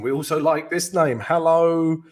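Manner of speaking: normal talking voice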